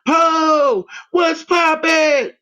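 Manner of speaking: very high, slow, springy greeting; bouncy springy boingy voice. Retold or imitated. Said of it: "And she would".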